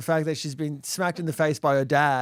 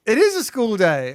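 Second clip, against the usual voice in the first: stern wife; 6 semitones higher, 6 dB louder than usual. Retold or imitated. Wife correcting him firmly.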